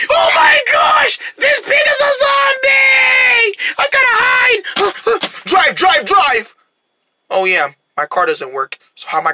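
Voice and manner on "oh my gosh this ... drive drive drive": very high, drawn-out shriek; panicked screaming. Acted. Rabbit fleeing a zombie pig, panicked. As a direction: squeal in terror, then urge frantically.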